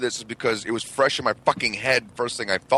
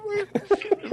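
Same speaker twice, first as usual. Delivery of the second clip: high squealing fright with a laugh; terrified kids at a vampire movie, squealing; mock terrified squeal.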